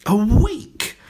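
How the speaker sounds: high, loud astonished cry; flabbergasted. A narrator's reading. Headmistress stunned by the answer, crying out loudly. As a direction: gasp it out in disbelief. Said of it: "Even the headmistress was flabbergasted".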